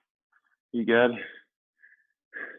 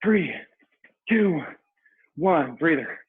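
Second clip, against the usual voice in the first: aerobics instructor; 6 semitones higher than usual. Real, not acted. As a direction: drive them through the last rounds. Coaching the final hard rounds.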